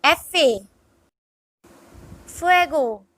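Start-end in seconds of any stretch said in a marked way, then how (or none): 0.0-0.6 F A run together as "EH-feh"; Spanish letter F. Shown as a demonstration. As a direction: say F A as one Spanish word, efe. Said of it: "F is pronounced as".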